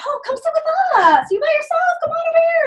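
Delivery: gushing invitation. Retold or imitated. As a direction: sweep the newcomer in eagerly. Group invites lonely newcomer.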